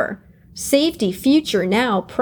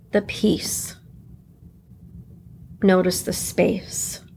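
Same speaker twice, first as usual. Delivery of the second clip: guided meditation. Acted. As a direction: let each notice settle gently. Guides calm noticing.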